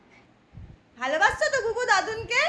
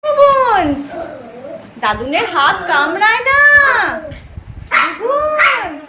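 mock scolding; 9 dB louder, slower than usual. Real, not acted. Grandmother scolding toddler for biting grandpa, loud call.

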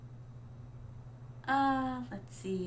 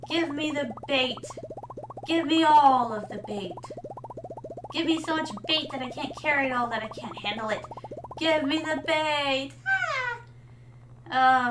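mock greedy demand; 2 semitones higher than usual. Streamer clamouring for bait at the shop, mock-greedy frenzy. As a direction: demand it greedily, hammed-up and escalating.

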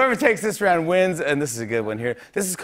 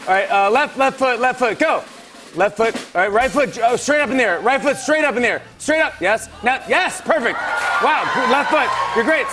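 calling out steps; 8 semitones higher than usual. Real, not acted. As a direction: shout each step to your blindfolded partner. Guiding blindfolded partner by shouting.

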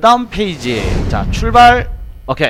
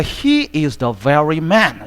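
every word of "He is the very man" spaced out very slowly; slow model reading; 2 semitones lower, much slower than usual. Shown as a demonstration. English teacher reading the example sentence very slowly. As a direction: read it out word by word for the class. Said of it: "명사를 강조할 때는 very가".

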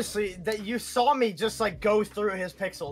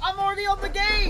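adrenaline rush; 12 semitones higher, 3 dB louder, faster than usual. Real, not acted. Heated gaming yelling.

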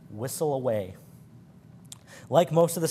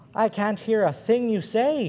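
slow, much higher shout; 8 semitones higher, 4 dB louder than usual. A narrator's reading. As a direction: call down, pretending not to hear. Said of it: "he shouted down".

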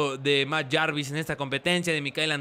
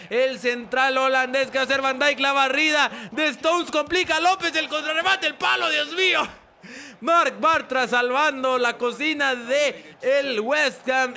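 fast, rising, louder goal call; live play-by-play; 7 semitones higher, 3 dB louder than usual. Acted. Commentator calling a goal replay for viewers, excited play-by-play. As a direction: build from brisk to high, loud excitement. Calls the last chances.

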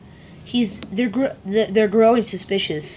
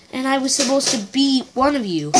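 ordinary delivery

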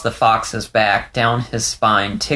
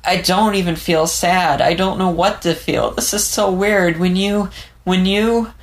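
put-on a young fox, voice pitched higher, on the edge of tears; Leo, shaken and tearful; 9 semitones higher than usual.

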